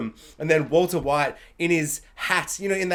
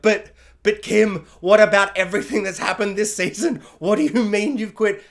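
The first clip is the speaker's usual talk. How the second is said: louder, slowed pleading as Saul to Kim; 4 semitones higher, 5 dB louder than usual. Retold or imitated. YouTuber voicing Saul begging Kim not to quit, loud pleading. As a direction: beg her like a stunned Saul. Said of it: "just being like".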